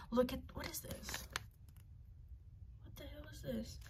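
hushed, baffled muttering; puzzled murmur. Real, not acted. Vlogger puzzling over a face mask, hushed. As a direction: ask it under your breath.

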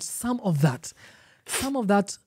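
"some of that" picked out, with weight on "some"; single out the words "some of that"; stress on "some of that". Shown as a demonstration. Teacher pointing out Musa's etiquette, stress on "some of that". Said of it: "emphasis on the word".

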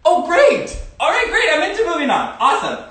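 high, loud mock cheer; overjoyed cheering at herself; excited. Mock cheerful self-talk.

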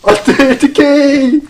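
high loud slow panicked cry; panicking gamer. Real, not acted. Danger in game.

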